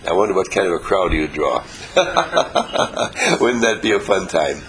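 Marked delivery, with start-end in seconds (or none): 1.7-3.4 high, rapid chuckle; amused run of ha-ha-ha; laugh. Real, not acted. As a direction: laugh at your own idea. Amused at own remark.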